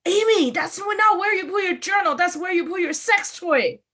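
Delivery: high, loud mom calling across the room; mom yelling. Retold or imitated. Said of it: "my mom yelling from across the room".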